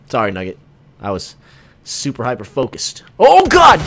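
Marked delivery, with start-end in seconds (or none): 3.2-3.8 very loud, high shriek of alarm; startled yell. Real, not acted. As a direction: yell as if suddenly ambushed. Suddenly attacked in game.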